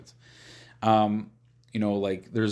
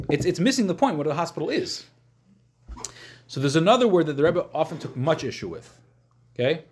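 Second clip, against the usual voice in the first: ordinary delivery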